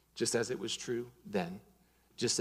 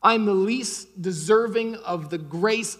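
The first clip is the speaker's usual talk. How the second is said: loud, driving preacher voice building to a peak; full-force preaching; 5 semitones higher, 10 dB louder than usual. Acted. Pastor preaching grace to the congregation, loud and driving. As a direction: preach it hard to the whole room.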